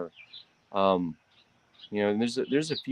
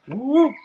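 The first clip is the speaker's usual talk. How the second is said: high surprised whoop; 8 dB louder than usual. Real, not acted. Exclamation while handling cat.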